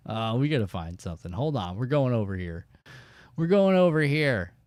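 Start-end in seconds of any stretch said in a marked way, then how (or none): 3.4-4.5 repeated louder and higher, insisting. Real, not acted. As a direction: call it out again so they follow. Repeats the direction more forcefully.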